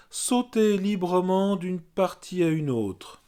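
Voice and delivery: measured meditation voice, spaced by pauses; guided yoga nidra. Real, not acted. Yoga teacher naming body parts for resting listeners, guided meditation voice. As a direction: guide awareness through the body, one evenly placed phrase at a time. Leads body rotation of consciousness.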